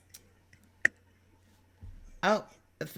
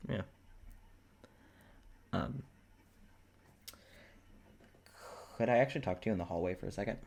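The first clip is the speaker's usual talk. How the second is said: lightly voiced awkward man, lower; hesitant and low, with long gaps; awkward Dimitri; 7 semitones lower, 7 dB quieter, faster than usual.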